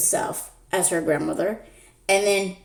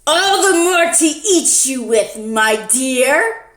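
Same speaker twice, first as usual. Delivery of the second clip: higher, louder menacing wolf; big bad wolf; 5 semitones higher, 4 dB louder, slower than usual. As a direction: reveal the wolf with relish. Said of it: "and he says".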